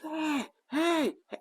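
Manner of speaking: high mock shout of hey; high-pitched retold yell; imitated yelling. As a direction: call out hey like a kid yelling. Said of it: "I see her yelling".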